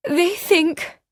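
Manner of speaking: the repeat climbs and snaps off; second halting try. A narrator's reading. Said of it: "she broke off".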